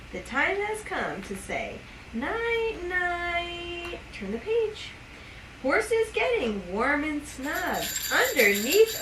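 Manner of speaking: bedtime story reading. Acted. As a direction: lull the baby toward sleep. Reading bedtime book to baby.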